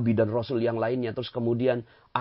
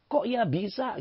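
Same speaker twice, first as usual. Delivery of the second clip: mock scolding wife; 10 semitones higher, slower than usual. Husband voicing scolding wife.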